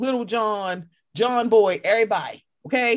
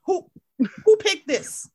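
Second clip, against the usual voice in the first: high, loud indignant who picked this; outraged disbelief; 11 semitones higher, 4 dB louder than usual. Retold or imitated. Podcaster venting to her cohost about the lineup, high indignant disbelief. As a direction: demand to know who did this.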